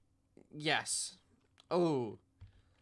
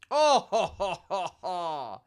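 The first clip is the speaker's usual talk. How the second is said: laughing; 4 semitones higher, 4 dB louder, slower than usual. Real, not acted. Laughing at a funny guess.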